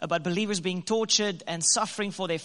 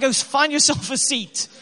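mock haughty command; 5 semitones higher, 6 dB louder than usual. Retold snub to wife.

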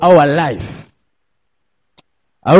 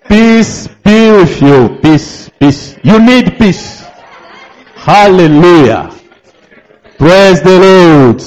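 rally the church with each "Praise the Lord"; preaching at full force. Acted. Preacher leads worship chant.

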